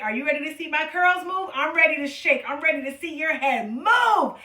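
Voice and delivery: ordinary delivery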